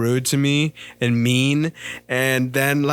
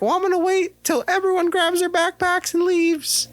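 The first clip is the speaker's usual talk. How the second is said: much higher, slowed mock of a scolding teacher. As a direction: put on a prissy teacher voice to mock her. Teacher voiced in retold story.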